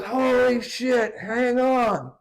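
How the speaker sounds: slow, high alarmed cry; alarmed shout. Retold or imitated. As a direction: cry out a warning as the wave hits. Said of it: "the last thing Fred heard was".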